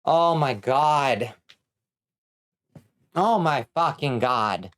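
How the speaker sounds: slow, drawn-out amazement; stunned disbelief. Real, not acted. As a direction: stretch each word as it sinks in. Realizes how good the sword is.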